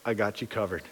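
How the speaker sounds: put-on calm a frightened child; soft, low comforting promise; gentle God's reassurance. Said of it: "He's like".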